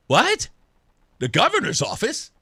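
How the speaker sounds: taken aback, asking back; surprised question. Retold or imitated. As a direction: question it in surprise, ending on a rise. His startled question back at Tim.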